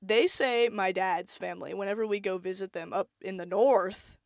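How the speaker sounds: ordinary talk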